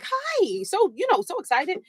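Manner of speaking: high, chirpy imitation of the date on the phone; eager date voice. Imitating her date answering the phone, high and eager.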